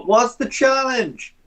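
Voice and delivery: high, drawn-out call across a distance; shouting over. Acted. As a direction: holler it slowly from afar. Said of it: "Just gonna shout over".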